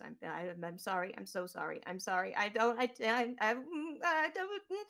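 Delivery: stammering apology rising to a high, loud squeak; a terrified droid begging not to be eaten; scared robot. Acted. BB pleading with Charlie not to feed him to the drake, rising to a panicked squeak. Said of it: "looks at Charlie and goes".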